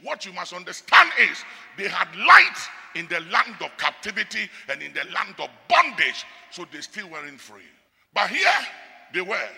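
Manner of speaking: loud, ringing pulpit warning; full-voiced sermon delivery; preaching at full force. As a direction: thunder it at the congregation like a preacher. Preaching on Israel's ingratitude.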